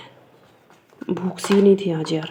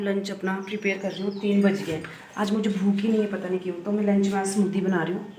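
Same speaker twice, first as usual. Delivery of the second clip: ordinary delivery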